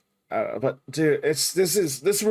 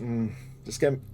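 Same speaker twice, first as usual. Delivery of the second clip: ordinary talk, nothing marked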